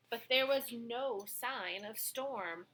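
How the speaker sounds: ordinary delivery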